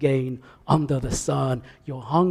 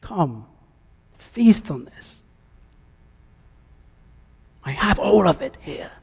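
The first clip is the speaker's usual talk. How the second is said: voice of God calling; 5 semitones higher than usual. Acted. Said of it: "And the voice says".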